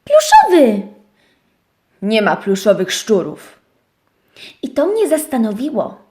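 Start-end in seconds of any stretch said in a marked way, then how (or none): 0.0-0.8 dużo wyżej i głośniej, wolniej, z przejęciem; podekscytowany okrzyk. Acted. Owieczka powtarza głośno. 2.0-3.6 niższy, stanowczy głos szczura; niski szczur. Acted. Szczur zaprzecza.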